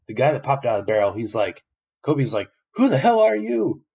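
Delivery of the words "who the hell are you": high, loud mock shriek; startled Kobe. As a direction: blurt it out loud and high in shock.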